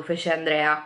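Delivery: ordinary talk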